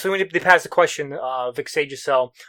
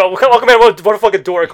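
mocking; 4 semitones higher, 10 dB louder than usual. Retelling everyone mocking Aquaman.

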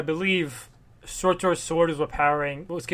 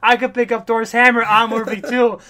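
Loki bragging, louder and higher, building to a yell; boastful shout; 5 semitones higher, 8 dB louder than usual. Retold or imitated. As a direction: gloat loudly at the crowd, pitch climbing. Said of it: "and says".

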